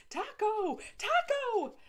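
very high, very loud screech of a dog's name; frantic shout. Acted. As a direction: shriek for the runaway dog.

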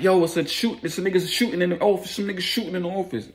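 high, fast urgent warning; acted alarm. Raises alarm about a shooting.

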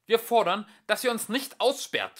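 loud, high angry snap; young philosopher snapping angrily. A narrator's reading. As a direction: snap the demand. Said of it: "schnauzte der Jüngere".

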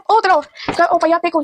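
normal talking voice

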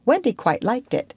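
usual speaking voice

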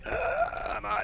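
high, squeezed heave; straining effort. Pretend. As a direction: plead through the effort of lifting. Straining to lift the bench.

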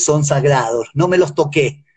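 ordinary talk, nothing marked

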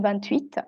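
"vingt-huit" with T linked into "huit"; sounded T. Shown as a demonstration. Teacher extends the rule for student, liaison T in "vingt-huit". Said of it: "Lo mismo con".

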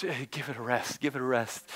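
weary mutter. Retold or imitated. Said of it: "it's just".

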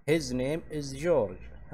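extremely slow, stress on His; slow model sentence. Shown as a demonstration. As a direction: dictate it word by word. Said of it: "زي هنا تقول". (نطق بطيء جدًا مع التشديد على His)

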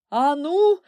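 high, loud barked command; panting bellow. A narrator's reading. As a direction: bellow it out of breath. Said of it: "відсапуючись, на кожному слові загорлав".

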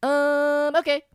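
high, bright Aziz Ansari voice; Aziz Ansari impression. Doing Aziz on request for friends, high and quick. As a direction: pitch way up like Aziz. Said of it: "do it, do it, do it".

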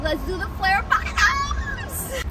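high quoted voice. Retold or imitated. Quoting themselves, high voice.

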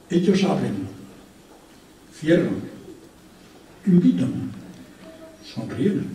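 sparse, halting reading with long pauses; lightly imitated poet reciting word by word; imitating the poet. Reading the poem as the poet would, pauses after each word, lightly imitated. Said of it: "Yo las voy a decir como las diría él".

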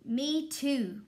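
hammed-up loud grunting character voice; grunting tuba. A narrator's reading. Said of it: "grunted Tuba".

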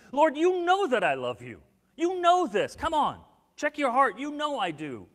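loud, high-pitched pleading insistence, easing at the end; sure, insistent appeal. Retold or imitated. Peter appealing to Jesus' conscience, sure and insistent. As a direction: press the point with full conviction. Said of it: "so sure of his love that he even appeals to Jesus' conscience".

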